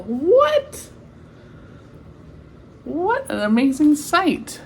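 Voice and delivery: high amazed what; surprised. Real, not acted. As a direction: squeak it out in disbelief. Amazed at Papa on bicycle.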